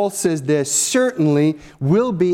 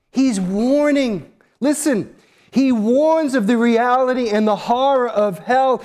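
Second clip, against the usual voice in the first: fervent preaching; 8 semitones higher than usual. Acted. Preaching at full force.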